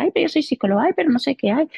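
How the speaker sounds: high, mocking imitation of a fussy complainer; a teasing parody of a self-important psychologist; mocking whine. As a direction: mimic her complaint with a mocking lilt.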